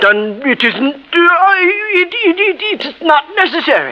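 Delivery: high, stammering panic; rattled millionaire sputtering a denial; flustered stammer. Acted. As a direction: trip over the words, cornered.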